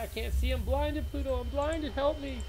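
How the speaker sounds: a playful plea to Pluto for rescue; mock distress. Over-the-top blinded victim begging his co-caster for help.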